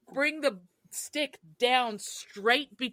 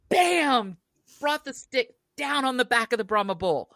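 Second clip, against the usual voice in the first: normal talking voice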